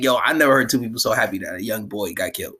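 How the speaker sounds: ordinary talk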